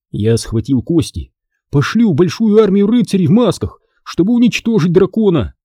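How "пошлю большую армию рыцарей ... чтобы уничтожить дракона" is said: high-pitched, emphatic declaration; announcing. A narrator's reading. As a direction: proclaim the plan with lifted voice. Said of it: "объявил я". (торжественно объявляя, высоким голосом)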